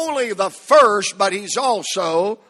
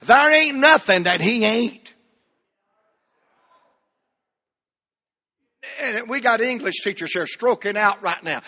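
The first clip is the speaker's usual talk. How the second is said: ain't-heavy preacher refrain; playful down-home preaching; folksy preaching; 2 semitones higher than usual. Acted. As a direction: lean into the grammar for effect. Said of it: "This ain't good English, but this is good preaching".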